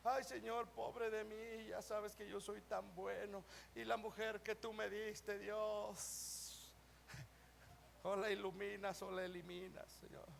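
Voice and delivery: low, soft mock pleading; mock self-pitying prayer. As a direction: whimper a hammed-up poor-me prayer. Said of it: "cómo oramos muchas veces".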